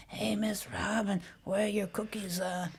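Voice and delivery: soft gravelly imitation of a boy; Adrian, gravelly. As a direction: rasp it quietly, ending on a stray "uh". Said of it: "his voice was kind of grovelly".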